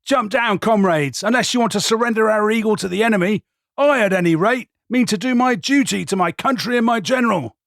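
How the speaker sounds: high, loud rallying shout; loud battle cry. Acted. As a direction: roar it to the troops, urgent. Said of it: "cried in a loud voice".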